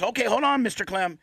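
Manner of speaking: lightly voiced staffer putting a patient on hold; intercom technician. Technician again over the intercom.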